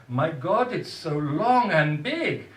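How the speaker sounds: loud, high, drawn-out marvelling; gasp-worthy discovery, hammed up slowly; mock amazement. Dentist exclaims in the poem.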